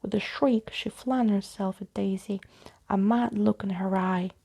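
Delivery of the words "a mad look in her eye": ordinary delivery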